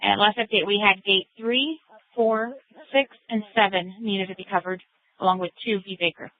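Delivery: high, thin dispatcher over radio; tinny radio dispatch voice; police radio. Real, not acted.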